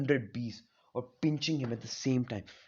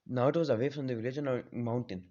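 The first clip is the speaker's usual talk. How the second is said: ordinary delivery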